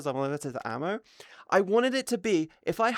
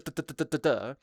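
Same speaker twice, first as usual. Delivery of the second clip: slow firing duhs; 4 semitones lower, faster than usual. Shown as a demonstration. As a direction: mimic normal fire rate. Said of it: "my normal attack speed was".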